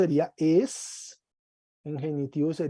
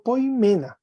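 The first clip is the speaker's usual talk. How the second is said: poimena with "me" lifted high, then falling; stress on ME; 6 semitones higher, 3 dB louder than usual. Shown as a demonstration. Teacher going through the accusative for a student, stress on "me" in poimena. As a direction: raise and accent "me" in poimena. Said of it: "del acento con fuerza".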